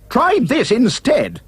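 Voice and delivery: slow, high retort; yelling back. A narrator's reading. Prince retorts to the second sister, yelling. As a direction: yell it back, drawn out and high. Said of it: "the prince yelled back".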